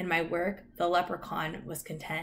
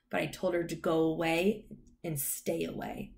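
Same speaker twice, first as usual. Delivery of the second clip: ordinary delivery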